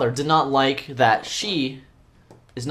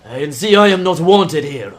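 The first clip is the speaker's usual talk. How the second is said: loud, slow, affronted announcement; wounded pride at full volume before storming out; indignant character; 3 semitones higher, 8 dB louder than usual. Acted. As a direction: declare it slowly and loudly, deeply offended. Acted exit of tripped, offended character.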